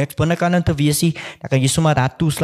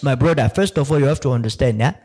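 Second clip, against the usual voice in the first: imitating a Nigerian pastor-like Siri; mock Nigerian accent. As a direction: preach about tithes in a mock Nigerian accent. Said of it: "Siri will be like".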